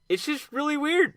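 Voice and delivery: ordinary delivery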